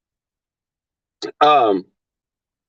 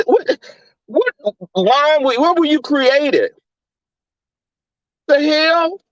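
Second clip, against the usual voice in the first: incredulous; 8 semitones higher than usual. Real, not acted. Outraged reaction to linebacker claim.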